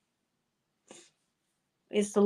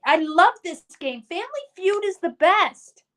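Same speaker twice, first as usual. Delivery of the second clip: higher, louder burst of delight; gushing enthusiasm; 6 semitones higher, 4 dB louder than usual. Real, not acted. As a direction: gush about a game you love. Gushing about favorite game at end.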